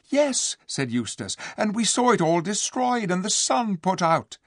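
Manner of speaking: put-on excited boy. A narrator's reading. Eustace exclaims in disbelief.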